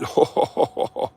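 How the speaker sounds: long voiceless run of chuckles; breathy laughter. Real, not acted. Driver sharing a moment with folks, breathy laughter.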